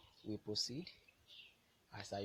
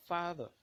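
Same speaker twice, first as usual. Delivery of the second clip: "father" with the first syllable hit louder and higher; stress on first syllable; 6 semitones higher, 6 dB louder than usual. Shown as a demonstration. Teacher showing learners a stressed syllable, "father" with a forceful first syllable. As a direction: put more force on the start of "father". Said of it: "a higher degree of force".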